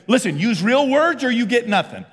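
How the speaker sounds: hammed-up strict parent laying down rules; mock stern parent. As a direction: lay down the law in mock sternness. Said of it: "I say".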